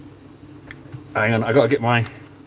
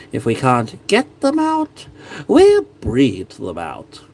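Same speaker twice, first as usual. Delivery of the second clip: slow, icy royal decree; English king impression; much slower than usual. Braveheart king impression, slow cold menace. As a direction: drawl it out, cruel and amused. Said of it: "excellent those impressions are".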